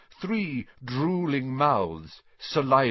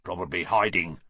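muttering caretaker; 4 semitones lower than usual. A narrator's reading. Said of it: "they heard him mutter".

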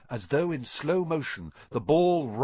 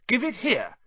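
high, firm call; raised, determined command; 11 semitones higher than usual. A narrator's reading. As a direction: call a demand across the air. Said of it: "Harry called".

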